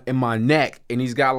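usual speaking voice

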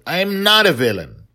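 put-on girl screaming at her father that she is not a villain; yell. A narrator's reading. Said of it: "Dragon Little yelled".

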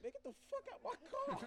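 high mock disgusted yell, laughing; disgusted friend. Friend ordering him out of the car, hammed-up high disgust. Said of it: "his friend, like, disgusted".

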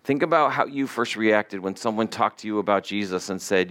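normal talking voice

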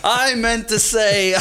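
high, slow, laughing through words. Retold or imitated. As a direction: say it while cracking up. Laughing doctor quoted.

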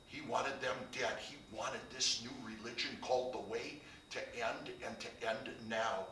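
usual speaking voice

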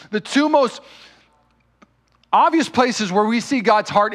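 loud, high preaching at full force; booming pulpit delivery, pleading and urgent; fiery preaching. Acted. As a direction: preach it out, building with passion.

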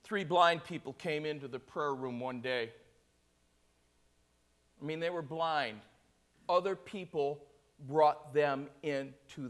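low, soft, suspenseful storytelling; hushed testimony. Acted. Preacher telling of three blind people in the clinic, hushed. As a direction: hold the room with a quiet, measured telling.

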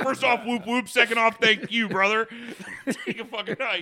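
high, loud and laughing; joking back at the stranger, laughing; laughing retort. Retold or imitated. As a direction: crack up through the comeback. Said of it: "I was like".

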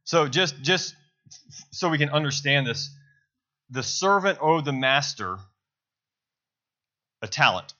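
ordinary talk

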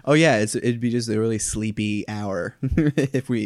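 ordinary talk, nothing marked

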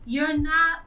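ordinary delivery

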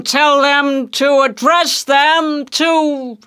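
high, slow, stretched-out ship's call; loud hail. Acted. Ahab to the stranger vessel through his trumpet, loud hail. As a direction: call out each word across the sea. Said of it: "he loudly hailed".

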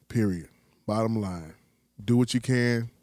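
normal talking voice

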